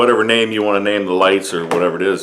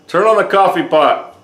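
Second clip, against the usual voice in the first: higher, louder restarted command; repeating to voice assistant; 7 semitones higher, 5 dB louder than usual. Real, not acted. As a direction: start over and say it clearly to the device.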